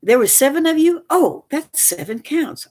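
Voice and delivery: scornful high-pitched parody; sarcastic mockery. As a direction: sneer at the prosecution's logic. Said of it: "I mean".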